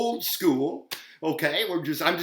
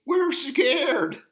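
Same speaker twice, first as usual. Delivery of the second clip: put-on scared child; 11 semitones higher, 3 dB louder than usual. Child in story.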